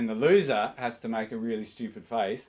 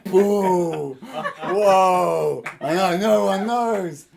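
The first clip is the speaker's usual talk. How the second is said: loud laughing whoa; hammed-up astonishment; mock amazement; 6 semitones higher, 9 dB louder, much slower than usual. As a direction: act blown away. Mind-blown exclamation.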